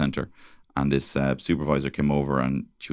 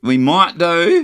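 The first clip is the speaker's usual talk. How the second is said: very high, loud, slow Australian echo; mock Australian accent; 13 semitones higher, 8 dB louder, much slower than usual. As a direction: squeal it in broad Australian. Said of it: "Like an Australian coming in".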